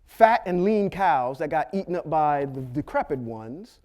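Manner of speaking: ordinary delivery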